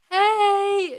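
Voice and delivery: loud, high, drawn-out hey; big squealed hello; excited greeting. Retold or imitated. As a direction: call it out to friends across the deck. Retold greeting on meeting group.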